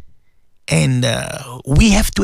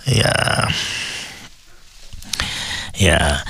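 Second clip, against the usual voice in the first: holding back tears; 5 semitones lower, 5 dB quieter, slower than usual. Real, not acted. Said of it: "I'm holding my tears".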